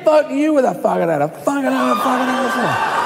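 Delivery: high, garbled torrent of swearing; mock sweary retort. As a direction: rant back in comic gibberish fury. Acts swearing back at abusers.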